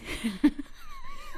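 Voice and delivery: squeaky laugh at herself; high laughing burst. Real, not acted. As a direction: let out a high giggle. Laughing at own slip.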